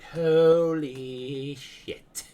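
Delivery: long drawn-out, high, loud awe; stunned, slow exclamation; awed shock. Acted. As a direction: stretch it out slowly in loud disbelief. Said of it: "you are just like".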